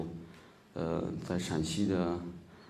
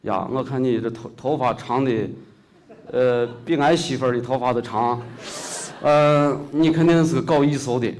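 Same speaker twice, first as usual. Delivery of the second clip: hearty Shaanxi shopkeeper, louder and rising; local shopkeeper; 5 semitones higher, 8 dB louder than usual. Retold or imitated. Shopkeeper realizes the young man is local, loud Shaanxi dialect. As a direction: call out like a surprised old Xi'an shopkeeper. Shopkeeper teasing about long hair.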